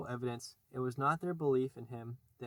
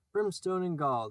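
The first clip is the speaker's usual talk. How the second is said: a higher, louder mock Hook oath; mocking retort; 5 semitones higher, 6 dB louder than usual. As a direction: throw his own curse back at him. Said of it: "the voice retorted".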